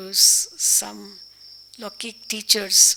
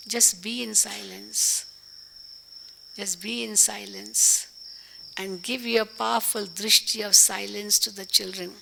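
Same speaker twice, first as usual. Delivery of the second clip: ordinary talk, nothing marked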